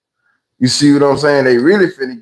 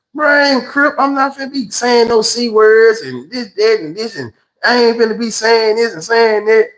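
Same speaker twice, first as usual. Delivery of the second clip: mock earnest protest; 7 semitones higher than usual. New inmate swearing off gang talk.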